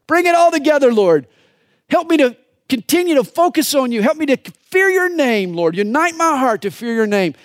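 preaching prayer at full force; impassioned prayer. Real, not acted. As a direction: plead with God at full voice.

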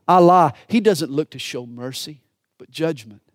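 normal talking voice

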